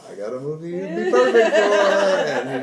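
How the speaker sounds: loud words broken up by laughter; laughing. Real, not acted. Laughing while retelling his text offering Depp a role. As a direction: crack up mid-sentence.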